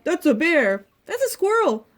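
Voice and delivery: loud, high rival claims; shouted argument. A narrator's reading. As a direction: insist loudly like squabbling animals. The others shout rival answers.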